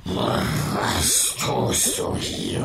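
slow, unvoiced hissing monster. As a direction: breathe it out like a beast. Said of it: "fragte das Ungeheuer".

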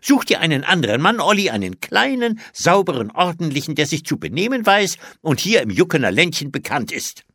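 high, insistent scolding; entschlossen. A narrator's reading. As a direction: lay down the law, determined and voluble. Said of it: "sagte die Tante entschlossen". (entschlossen schimpfend)

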